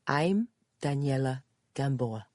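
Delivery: put-on Spanish-accented self-introduction. A narrator's reading. Visitor gives her name to Judy, in a Spanish accent. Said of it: "answered the woman with a Spanish accent".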